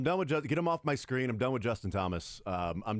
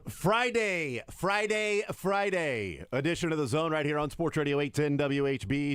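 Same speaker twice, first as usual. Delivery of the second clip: big higher, louder radio host opener; show opener; 5 semitones higher, 4 dB louder than usual. Acted. Host opening the Friday radio show, booming announcer hype.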